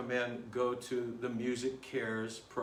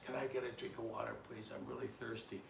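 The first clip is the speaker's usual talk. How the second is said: hushed, feeble request; dying of thirst; 2 semitones lower, 11 dB quieter, faster than usual. Acted. Thirsty man asks at second door for water, soft and weak. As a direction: beg softly as a parched traveler. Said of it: "dying of thirst".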